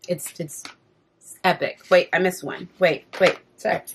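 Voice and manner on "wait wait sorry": ordinary delivery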